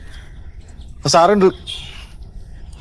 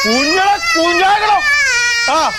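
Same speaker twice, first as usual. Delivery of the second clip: wailing cry. Acted. Crying out for the son, high and wailing. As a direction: wail and sob out the words.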